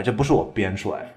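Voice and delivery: normal talking voice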